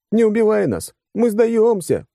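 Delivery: нарочито испуганная высокая мольба; театрально взмолись, переигрывая; переигранная мольба. Acted. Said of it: "слишком переигрываешь". (наигранный испуг)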